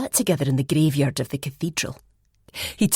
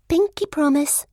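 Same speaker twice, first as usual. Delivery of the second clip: put-on high, louder amused girl; high character voice; 12 semitones higher, 3 dB louder than usual. A narrator's reading. Elspeth laughing at the story, high and amused.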